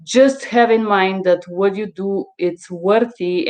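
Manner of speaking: usual speaking voice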